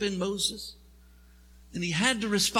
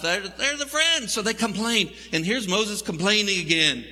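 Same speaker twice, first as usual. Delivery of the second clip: ordinary delivery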